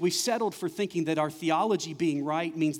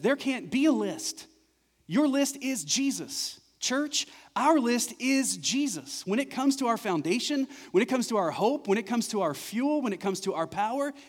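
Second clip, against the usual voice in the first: high, loud climactic preaching; preaching at full force; 9 semitones higher, 5 dB louder than usual. Real, not acted. Pastor urging church, preaching at full force. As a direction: build the congregation up to the peak.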